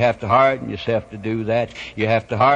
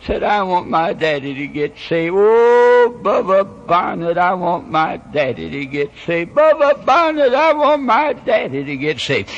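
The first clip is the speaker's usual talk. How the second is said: put-on high wailing child's plea; crying little girl; 9 semitones higher, 3 dB louder than usual. Little girl pleading to preacher for her daddy, crying. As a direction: imitate a tearful small child, pitch climbing. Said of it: "in her little old baby way, she began to cry".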